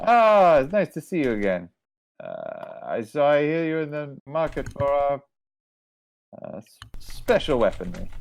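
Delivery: lightly French-tinged trader voice; lightly voiced merchant lady. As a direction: play the shopkeeper, pleased to see an old customer. GM voices merchant Mama Tuk Tuk.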